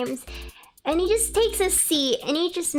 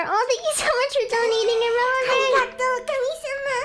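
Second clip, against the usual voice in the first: much higher, sweet squeaky thanks; cutesy thank-you voice; 10 semitones higher, faster than usual. Acted. Streamer thanking a new subscriber in a high cutesy voice. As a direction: gush over the chat like an excited anime girl.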